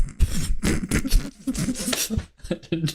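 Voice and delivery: high delighted laugh bursting out; burst of laughter. Real, not acted. Watching the chart nail the peak, bursting into laughter. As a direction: crack up at the chart. Sees tool hit the peak.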